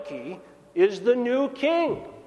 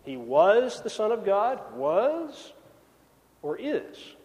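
the correction of "was" to "is", with a pause between them; stress on was; slower than usual. Shown as a demonstration. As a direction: lean on "was" to set up the correction. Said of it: "the last lie of Lent".

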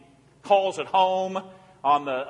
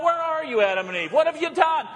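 calling out; 10 semitones higher than usual. Acted. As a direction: call out high as if across a garden. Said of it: "God calls out".

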